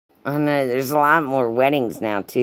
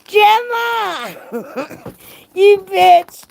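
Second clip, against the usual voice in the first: high, loud delighted squeal of a name; excited shriek; 4 dB louder, much slower than usual. Real, not acted. Streamer squealing at a friend in chat, delighted. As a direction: squeal it like spotting a best friend.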